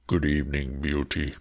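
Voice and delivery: low, deep beast voice; a monster trying to sound courteous; terrifying beast. Acted. Beast greets Beauty in a low, heavy voice. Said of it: "in a tone that might have struck terror".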